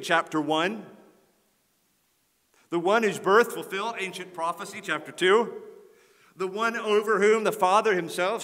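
loud, building preacher cadence; rising preaching. Acted. Pastor building his case to the congregation, loud building preaching. Builds a list of Jesus' deeds.

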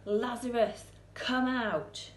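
loud commanding call, roaring like a lion; mighty yell. Acted. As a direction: roar it into the tomb. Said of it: "he yelled like a lion's mighty roar".

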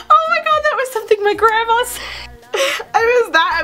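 high-pitched, squealing through laughter; cracking up. Real, not acted. Cracking up at old joke.

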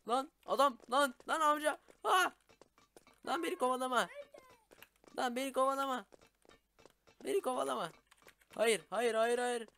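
high, loud panicked yelling; hammed-up panic, fleeing a chaser; being chased. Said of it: "Biraz rol yaptım arkadaşlar".

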